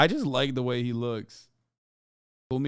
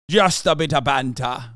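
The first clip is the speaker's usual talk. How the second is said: mock British; 7 dB louder than usual.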